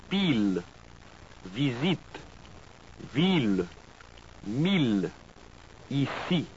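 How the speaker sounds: each word in French, last syllable stressed, pure i vowel; French word list. Shown as a demonstration. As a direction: read the French words slowly and clearly for learners. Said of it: "Listen to them".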